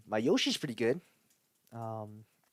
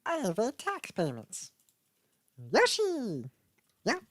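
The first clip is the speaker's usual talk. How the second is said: high squeaky dinosaur chirp; Yoshi voice; 9 semitones higher, much slower than usual. Retold or imitated. Trying out more Yoshi lines for the viewers. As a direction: chirp like the little green dinosaur. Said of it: "what else does Yoshi say".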